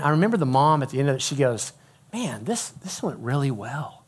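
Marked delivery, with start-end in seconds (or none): 2.1-4.0 softly surprised, retold; understated, hushed surprise; surprised mom. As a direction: voice a mom's quiet amazement. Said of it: "Like, she's really surprised".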